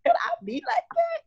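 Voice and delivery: very high, gushing voice full of awe; a friend gushing in admiration, pitched very high; admiring gush. Retold or imitated. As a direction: squeal it like a starstruck fan. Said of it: "I'm like".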